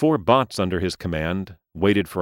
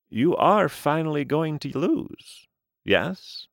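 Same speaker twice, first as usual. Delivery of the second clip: aging gentleman voice; 7 semitones higher than usual. Acted. Mister Lee speaks.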